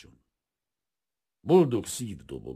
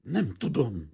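higher, louder scared reply; frightened stammer; 7 semitones higher, 5 dB louder, slower than usual. A narrator's reading. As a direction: answer as a terrified maid. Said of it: "dadogta a leány". (rémülten dadogva)

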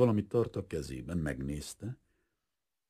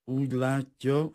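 slow, raised, undisturbed formal tone; unperturbed; 9 semitones higher, 5 dB louder than usual. A narrator's reading. Father continuing his prepared speech to the suitor, unperturbed. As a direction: carry on slowly as if never interrupted. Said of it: "folytatta Kunzler Adolf zavartalanul".

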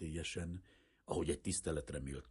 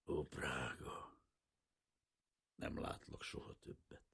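very soft, slow, whispery lament; inward sob; 3 semitones lower, 9 dB quieter than usual. A narrator's reading. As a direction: grieve almost without voice. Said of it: "egy zokogó hang".

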